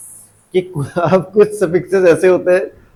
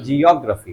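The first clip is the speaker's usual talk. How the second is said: "Geography" as jee-OG-ruh-fee; stress demo; 3 semitones lower than usual. Shown as a demonstration. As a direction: open jee first, then stress the ऑ in "geography". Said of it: "क्या बोलते हैं?". ("Geography" में ऑ पर ज़ोर)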